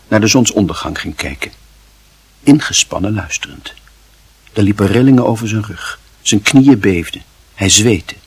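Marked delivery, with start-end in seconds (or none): none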